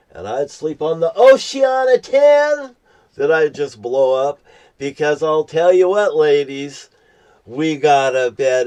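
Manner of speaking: pitching his beach bed to the ladies, loud and excited; excited boast. Real, not acted. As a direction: hype up the bed like a showman.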